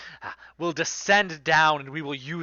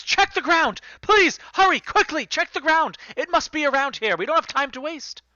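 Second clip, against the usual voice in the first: high, louder, hurried commands; urgent orders; 13 semitones higher, 5 dB louder than usual. Acted. Captain shoving the crew to search the ground, urgent high commands. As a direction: push them to hurry, pleading.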